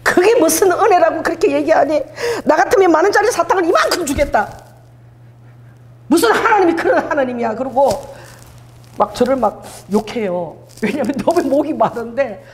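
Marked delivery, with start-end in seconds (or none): 0.0-7.5 imitating an irritated friend's outburst; mock angry scolding. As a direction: scold like an offended church friend. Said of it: "막 화를 내".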